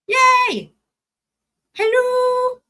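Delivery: high, bright squeal of welcome; excited greeting. Real, not acted. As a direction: cheer as a friend arrives. Friend joins the stream.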